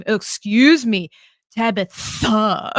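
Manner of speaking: mock offence, stretching the TH in "Tabitha"; over-enunciated TH. Aunt retorting to teasing niece, "Tabitha" with exaggerated TH. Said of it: "really over enunciate, uh, and exaggerate".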